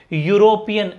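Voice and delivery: ordinary talk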